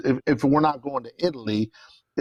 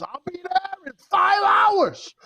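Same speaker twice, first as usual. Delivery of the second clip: excited friend; 4 dB louder than usual. Retold or imitated. Friend's excited phone call retold.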